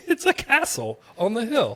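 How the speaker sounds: gasping wonder at the castle; amazed exclamation. Retold or imitated. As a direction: relive the moment you spot it. Quoting own amazed exclamation.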